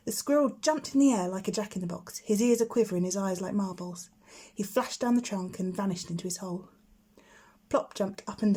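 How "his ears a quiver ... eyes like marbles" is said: plain reading voice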